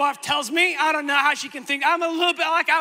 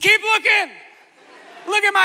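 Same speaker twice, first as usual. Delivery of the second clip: insistent plea; 5 semitones higher, 5 dB louder than usual. Retold or imitated. Begging the doctor to keep looking.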